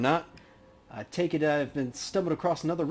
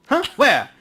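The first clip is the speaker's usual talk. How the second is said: startled; 12 semitones higher, 11 dB louder than usual. Acted. Justin startled.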